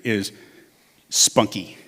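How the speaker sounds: ordinary talk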